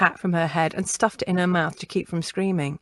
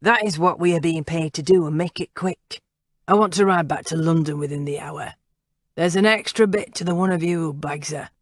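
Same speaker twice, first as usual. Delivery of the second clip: cold orders from a rough killer; gravelly leader. Acted.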